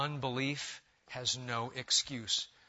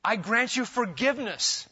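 preaching climax; 7 semitones higher, 6 dB louder than usual. Acted. Preacher climax quoting Jesus.

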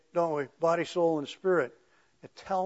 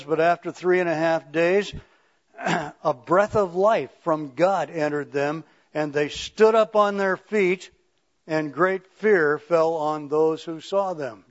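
slow, emphatic Bible reading; scripture reading; 5 dB louder, slower than usual. Acted. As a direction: proclaim the verse slowly, weighting key words. Reads scripture aloud.

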